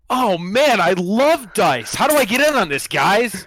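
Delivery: loud, high fake-newbie enthusiasm; eager yell. Player yells to dice gamblers, posing as recruit, eager yell. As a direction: yell it like an overkeen new recruit. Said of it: "I yell".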